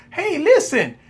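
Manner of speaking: ordinary talk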